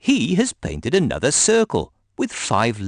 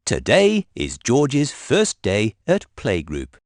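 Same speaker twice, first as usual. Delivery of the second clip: plain reading voice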